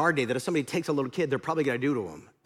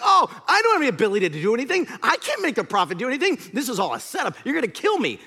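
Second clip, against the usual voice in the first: mock panic, fast at first, raised voice; terrified king; 6 semitones higher, 5 dB louder, faster than usual. King to Naaman's party, suspecting a setup, panicked. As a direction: protest in a hammed-up fright. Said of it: "the king of Israel is terrified".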